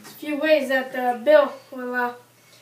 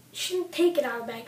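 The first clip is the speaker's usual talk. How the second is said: usual speaking voice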